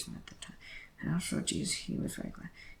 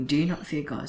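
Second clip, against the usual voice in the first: louder, stern rebuking read; a stern, louder rebuke read aloud; 9 dB louder than usual. A narrator's reading. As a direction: scold him with a raised voice. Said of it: "the other rebuked him".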